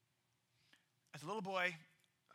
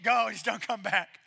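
a louder, hammed-up scared shooing; mock cowardly plea; 2 semitones lower, 5 dB louder than usual. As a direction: shoo the lion away without daring to fight. Scared shepherd gives up.

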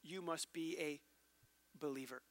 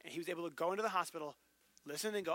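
normal talking voice

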